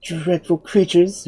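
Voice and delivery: slow, high-pitched horror; exclaiming. A narrator's reading. Unseen woman hearing of the Mangaboos, appalled exclamation. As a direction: exclaim it, stretching the words. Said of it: "exclaimed the woman's voice".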